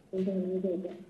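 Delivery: muffled voice over the phone; muffled, hand over mouth. Real, not acted. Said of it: "you're getting muffled".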